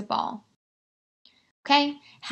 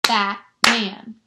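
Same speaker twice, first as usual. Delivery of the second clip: "Batman" in two beats, BAT, pause, MAN; split into syllables; 4 dB louder than usual. Shown as a demonstration. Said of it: "if you slow down".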